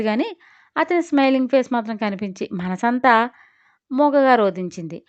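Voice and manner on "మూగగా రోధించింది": ordinary delivery, nothing marked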